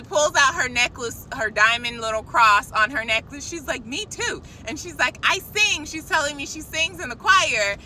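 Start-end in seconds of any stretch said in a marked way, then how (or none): 3.9-4.4 high, soft eager reply; small thrilled mock squeal; imitating excited woman. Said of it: "she was just so excited". 5.2-5.8 high, eager little announcement; giddy retold confession; imitating excited woman. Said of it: "she was just so excited".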